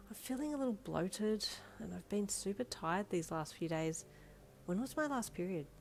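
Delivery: soft, hushed inner monologue; thinking aloud. A narrator's reading. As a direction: wonder quietly to yourself. Private worried thought to herself.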